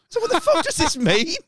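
exasperated, wide-eyed voice; real baffled outrage; indignant disbelief. Real, not acted. Outraged aside at the excuse.

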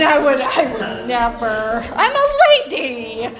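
a hint of a prissy proper lady, voice jumping up on I'm a; mock prim lady. Joking to the room about fancy stuff, in a mock prim lady voice. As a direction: lightly play the offended proper lady.

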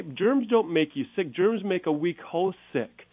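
Germs don't make you sick said slow, with weight on don't; slow down and lean on each word to correct a myth; slow emphatic point. Real, not acted. Doctor correcting a myth for moms, slow and emphatic. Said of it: "emphatic correction, slowed".

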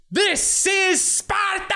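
a coked-up groom yelling the movie line; hammed-up Sparta shout. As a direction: bellow it like the film.

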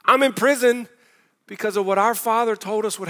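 mock plea. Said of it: "he goes".

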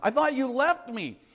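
put-on high-pitched, mildly upset boy; a touch of hurt child; crying out. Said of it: "he cries out to his mother".

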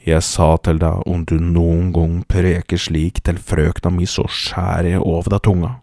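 loud, forceful, low-pitched warning; loud threat. Acted. Hunter confronts the general he has attacked, loud threat. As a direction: drive the threat home hard. Herbert carries out his threat on Alfred.

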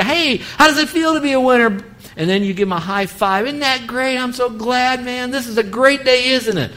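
high, bright cheering for the winner; excited parent. Retold or imitated. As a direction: share his joy, high-five energy. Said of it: "to feel that excitement".

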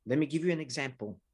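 ordinary talk

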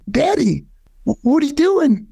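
put-on high, worried little boy's question; a frightened kid stammering at his dad; child's plea. Child voice confronting leaving father.

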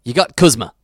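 punchy, light character impression.

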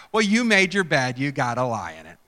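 smug I-told-you-so. Acted. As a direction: rub it in, self-satisfied. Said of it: "you can hear the smugness".

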